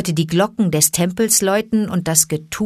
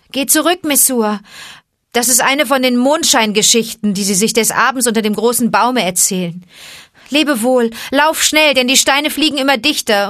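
higher, louder urgent goodbye; urgent farewell; 6 semitones higher, 4 dB louder than usual. Acted. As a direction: send her away before the stones. Mowgli sends mother away. (dringlicher Abschied, höher und lauter)

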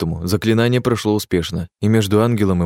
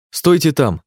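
raised, urgent shout; 7 semitones higher, 3 dB louder, slower than usual. A narrator's reading. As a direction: call out to stop them. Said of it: "Повторил я выкрик".

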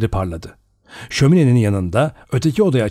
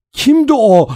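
loud, much higher scared cry; frightened cry; 12 semitones higher, 5 dB louder than usual. A narrator's reading. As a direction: scream the question in fear. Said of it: "diye haykırdı Vera". (korkuyla haykırış)

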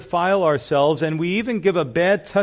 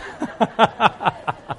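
real laughter after the joke; hearty laugh; 4 semitones higher than usual. Real, not acted. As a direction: let a big laugh roll out. Laughing at own punchline.

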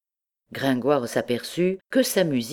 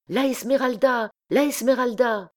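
high-pitched crowd chant; excited repeated cry; 9 semitones higher than usual. A narrator's reading. Crowd rushing to the windows, repeating the excited cry. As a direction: echo the cry, pitched far up. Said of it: "et répétant".

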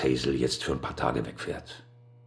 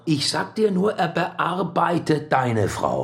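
insinuating; 13 semitones higher, slower than usual. Acted. Insinuating warning to Carter.

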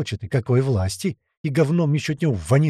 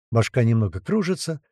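plain reading voice

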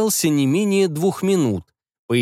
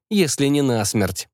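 ordinary delivery

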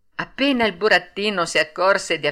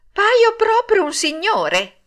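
high, loud and slow, showing off; strutting vanity; 14 semitones higher, 6 dB louder, slower than usual. Acted. Puppet admiring himself in the water, strutting and proud. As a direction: preen like a little dandy.

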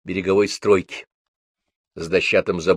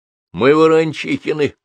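put-on higher, louder boy stepping forward; boy answers; 7 semitones higher, 5 dB louder than usual. A narrator's reading. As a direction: speak up boldly for the family. Pashka answers the old man. (мальчик смело отвечает)

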